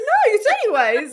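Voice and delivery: high, loud laughing protest; protesting to friend Patty, high and laughing; mock protest. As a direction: protest like it's unfair. Retold self protesting.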